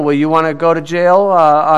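normal talking voice

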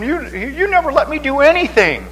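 put-on high, whining teen protest; whiny teenager. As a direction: whine like a sulky teen. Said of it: "teenagers complain".

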